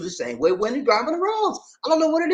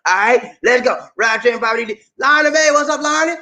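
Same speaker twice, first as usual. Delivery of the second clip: ordinary talk, nothing marked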